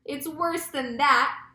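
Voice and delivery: mildly hammed-up alarm; cried out. A narrator's reading. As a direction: call out dire news slowly. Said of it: "cried the chief of police".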